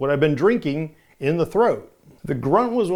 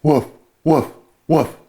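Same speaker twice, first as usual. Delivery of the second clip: gruff distorted woof; dog woof; 5 dB louder than usual. Shown as a demonstration. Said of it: "when I would woof with the dog".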